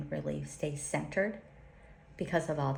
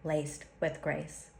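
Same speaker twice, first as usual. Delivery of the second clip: prayer. Acted. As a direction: bless them as you close the prayer. Closing blessing read to listeners.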